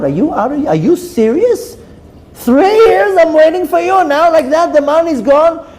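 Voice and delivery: outraged, pitch climbing high; incredulous, building to a loud high outcry; freaking out. Acted. Wife to husband after waiting three years, freaking out angrily. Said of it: "The wife starts freaking out".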